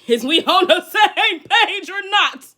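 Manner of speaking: loud, high laughing question; laughing yell. Real, not acted. Streamer laughing loudly asking chat if they agree. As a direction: holler it through a laugh.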